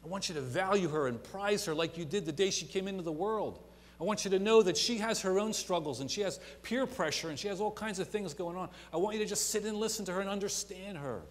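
preacher voicing God's call; impassioned preaching. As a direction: urge her from the pulpit. Preaching at full force.

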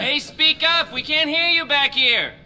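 called out from the back of the room; heckling call. Acted. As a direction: shout it across the crowd.

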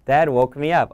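plain reading voice

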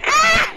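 high shriek of fright; startled scream. Real, not acted. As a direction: shriek as the monster appears. Jump scare.

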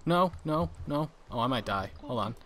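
usual speaking voice

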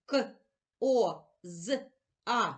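к, о, з, а as four separate short sounds. Shown as a demonstration. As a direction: say к, о, з, а one isolated sound at a time. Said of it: "Промовимо всі звуки".

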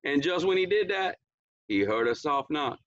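ordinary delivery, nothing marked

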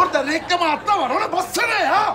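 mock-furious yelling at a clueless old man; exasperated yell. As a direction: snap it at him like he's hopeless.

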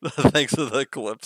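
laughing through slow, loud words; drawn-out, laughing thanks. Real, not acted. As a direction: thank them while cracking up. Laughing at a friend's clip.

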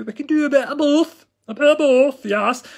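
high, loud, cartoonish squeak; cheeky high-pitched repeat; squeaky playful voice. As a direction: pop it up into a silly squeak.

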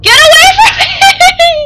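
loud yell breaking into a laugh; half panic, half laughter at the shark; laughing shout. Real, not acted. Tiger shark approaching.

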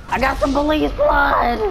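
high, gleeful exclamation; excited. Real, not acted. Player boasting of a hit on police, excited. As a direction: crow over the hit.

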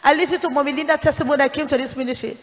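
lightly voiced congregation member; imitating listeners. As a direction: toss off what the audience says, quickly. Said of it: "Some of you say".

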